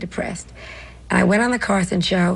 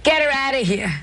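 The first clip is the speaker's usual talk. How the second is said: owner's order; 6 semitones higher than usual. Retold or imitated. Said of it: "the owner said".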